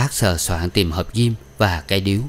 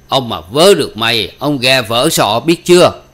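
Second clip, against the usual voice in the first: loud, higher-pitched furious threat; angry threat; 4 semitones higher, 6 dB louder than usual. A narrator's reading. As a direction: threaten a beating at the top of your voice. Old man threatens a beating.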